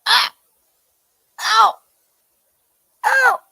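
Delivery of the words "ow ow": sympathetic ow. Retold or imitated. Voicing the pain.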